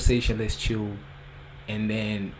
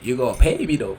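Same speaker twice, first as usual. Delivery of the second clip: higher, louder, amused from laughing; laughing demand; 3 semitones higher, 9 dB louder, faster than usual. Real, not acted. Rapper jokes that he won't perform for free, laughing demand. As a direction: demand it through the tail of a laugh.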